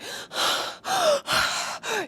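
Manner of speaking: high breathy shocked gasp; mock horrified gasp; dramatic gasp. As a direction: suck in air in mock horror.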